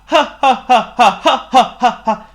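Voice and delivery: high, loud, repeated ha-ha; effortful mock laughter; attempted laugh. A narrator's reading. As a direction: try out a laugh like a crying king learning how. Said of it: "The king tried to laugh".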